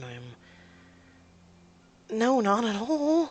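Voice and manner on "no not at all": high, slow, emphatic refusal; indignant denial. Acted. Woman rejects partner's insurance plan, indignant. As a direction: draw out an offended no.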